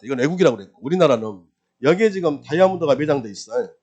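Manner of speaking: ordinary talk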